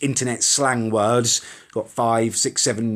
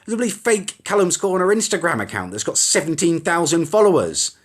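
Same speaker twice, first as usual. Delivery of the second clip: ordinary talk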